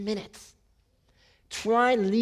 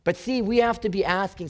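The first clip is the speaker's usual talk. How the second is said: ordinary talk, nothing marked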